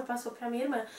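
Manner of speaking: ordinary talk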